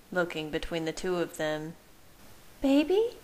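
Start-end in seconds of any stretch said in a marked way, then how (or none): 2.6-3.2 high, rising incredulous question; disbelief. A narrator's reading. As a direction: voice a woman's disbelieving thought. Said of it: "Alice thought in disbelief".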